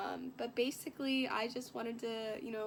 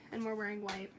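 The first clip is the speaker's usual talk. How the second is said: ordinary talk, nothing marked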